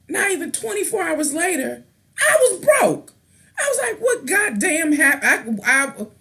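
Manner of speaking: high-pitched exasperated ranting; irritated outburst. Real, not acted. Ranter venting about going broke right after payday, exasperated. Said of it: "I'm irritated".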